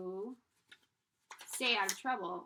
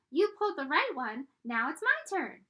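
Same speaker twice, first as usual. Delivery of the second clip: loud, higher tiny complaining voice; a little pigtail demanding its turn; whining pigtail; 5 semitones higher, 8 dB louder than usual. Acted.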